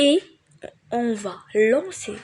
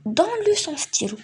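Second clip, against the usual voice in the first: commanding order; 6 semitones higher than usual. Shown as a demonstration. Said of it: "un ordre".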